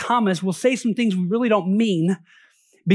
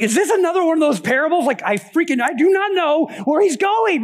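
acted exasperation, pitched higher; frustrated; 6 semitones higher than usual. Disciple fed up with yet another parable, frustrated. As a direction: vent like a baffled, fed-up follower. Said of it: "I'm sure there's a little frustration".

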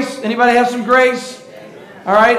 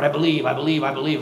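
straining to believe; 6 semitones lower, 6 dB quieter, faster than usual. Retold or imitated. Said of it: "think it's just thinking really hard".

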